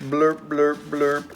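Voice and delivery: loud blaring blurp; horn sound. Pretend. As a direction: honk like a horn. Parent imitating a horn.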